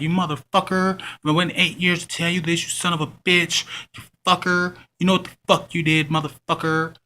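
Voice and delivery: mock angry woman cursing him out; hostile rant. Ex-fling cursing him out over Facebook, mock furious. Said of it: "she just hostile as shit".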